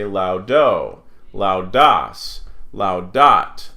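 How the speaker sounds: "laudo, laudas, laudat" with the last syllable punched; wrongly end-stressed "laudo, laudas, laudat". Shown as a demonstration. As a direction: lean hard on the endings of "laudo, laudas, laudat". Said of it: "We wanna emphasize the part that changes".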